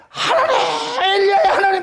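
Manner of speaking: high, loud cry to God; loud invocation. Retold or imitated. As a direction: call out to heaven in triumph. Elijah calls on God at the altar.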